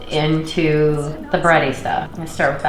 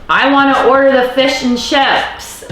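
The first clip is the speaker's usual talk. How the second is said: high, loud, eager pub order; mock eager order; 8 semitones higher, 5 dB louder than usual. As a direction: say it like a hungry customer at the bar.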